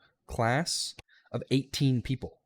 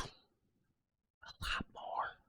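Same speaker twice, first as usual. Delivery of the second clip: slow whispered aside, much softer; 15 dB quieter, much slower than usual. Real, not acted. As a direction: breathe it out as a secret aside. Aside about strict instructor.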